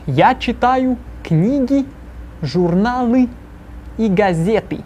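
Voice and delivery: slow, careful model sentence. Shown as a demonstration. Teacher models the answer slowly for students to repeat. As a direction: lay it out slowly so it can be echoed. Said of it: "this painfully slowly".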